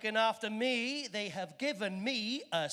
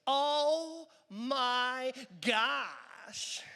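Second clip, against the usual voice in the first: drawn-out American "oh my gosh"; mock American awe, slow and loud; American gush; 2 semitones higher, 3 dB louder, much slower than usual. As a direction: gasp it out slowly like a wowed American tourist. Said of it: "The first thing the Americans say".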